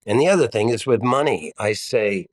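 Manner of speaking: ordinary delivery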